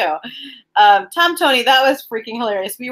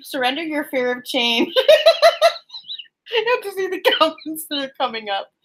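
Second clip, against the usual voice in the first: words breaking into high giggles; real laughing fit; 6 semitones higher than usual. Real, not acted. Card reader cracked up by viewers' comments, laughing. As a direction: try to talk through helpless laughter.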